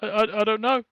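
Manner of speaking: squeaky high panicked kobold voice; a terrified little thief babbling apologies; blubbering kobold. Acted. Kobold caught stealing carrots, panicked high squeak.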